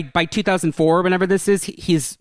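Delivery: normal talking voice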